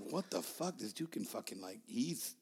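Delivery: low, soft disbelief; hushed impressed reaction; retold amazement. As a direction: say it under your breath, stunned. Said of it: "I was like".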